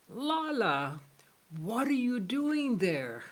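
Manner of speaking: astonished questioning. Acted. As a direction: act out the saint's amazed call to Lala. Saint finds Lala again, as parrot.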